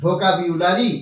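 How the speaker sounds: ভোকাবুলারি with a clear "byu" glide; careful "bhokabyulari" for ভোকাবুলারি; correct pronunciation. Shown as a demonstration. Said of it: "তাহলে সঠিক উচ্চারণ".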